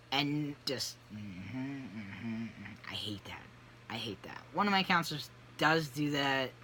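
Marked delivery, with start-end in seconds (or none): 1.1-2.9 soft, low mock listening hums; nodding therapist. Imitating a counselor during a session, quiet automatic murmur. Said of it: "learning how to nod your head". 2.9-4.4 low, soft grumble; muted disgust. Real, not acted. Client grumbling about nodding counselors, quiet dislike.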